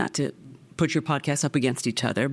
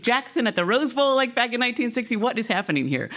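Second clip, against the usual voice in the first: incredulous; 8 semitones higher than usual. Retold or imitated. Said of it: "I'm like".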